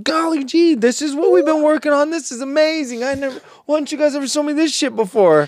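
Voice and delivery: high, gushing dork voice; goofy excited voice. Retold or imitated. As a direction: squeal it like a starstruck fan. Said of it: "He's just like".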